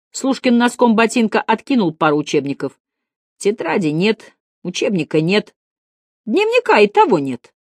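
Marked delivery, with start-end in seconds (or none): 3.4-7.5 higher-pitched, mildly disgusted listing; fastidious, unimpressed inventory; squeamish disdain. A narrator's reading. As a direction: list it with a touch of distaste. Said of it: "брезгливо сказал он".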